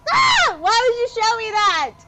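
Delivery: high, loud shriek of disgust; horrified shout. Real, not acted. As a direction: recoil and yell at the screen. Horrified at a corpse on the beach.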